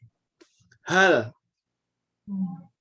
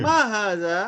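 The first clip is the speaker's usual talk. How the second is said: loud, higher question read slowly for repeating; teacher model reading; 6 semitones higher, 3 dB louder, slower than usual. Shown as a demonstration. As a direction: model the question for the class to echo. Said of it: "Sekarang saya baca lagi". (guru membaca contoh pertanyaan dengan keras)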